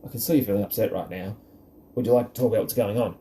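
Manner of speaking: fast and impatient, the words rattled off. Shown as a demonstration. Parent rattling off the phrase to an upset child, fast and impatient. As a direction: rush through it as if you just want it over. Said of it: "Really is not gonna help anyone".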